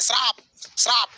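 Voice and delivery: श्राप said shraap, sh and r run together; the teacher insists on श्राप, not शराब; clarified word shraap. Shown as a demonstration. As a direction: say श्राप with a tight shr cluster. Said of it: "हम शराब नहीं कर रहे हैं, श्राप".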